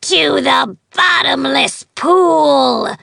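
loud, high slow scream. Acted. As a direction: scream the curse at her. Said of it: "she screamed".

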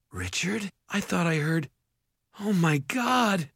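high, dazed, then aghast; dawning horror. A narrator's reading. Mother waking to see the ogre, aghast. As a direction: start small and confused, rise to a gasp of horror.

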